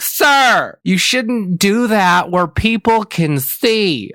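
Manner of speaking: high, loud imitation of a scolding lady; drawn-out mock scolding; yelling. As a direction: mimic an indignant stranger yelling, slowly stretched. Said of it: "yelling at me".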